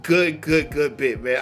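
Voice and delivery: normal talking voice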